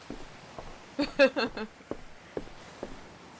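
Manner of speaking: amused chuckle at the game; amused laugh. Acted. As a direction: laugh at the prank. Captain amused by the joke.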